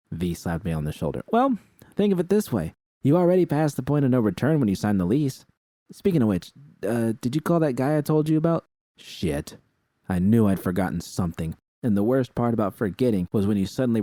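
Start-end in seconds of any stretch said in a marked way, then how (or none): 1.3-8.7 V voiced higher and a bit louder; higher, louder V insisting she's serious; V's higher voice. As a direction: lift the pitch for V, earnest.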